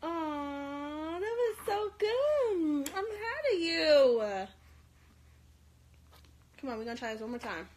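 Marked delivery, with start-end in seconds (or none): 0.0-4.2 louder, slow, crying through the praise; tearful praise. Real, not acted. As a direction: praise her while choking up with joy. Mother moved by baby's first try.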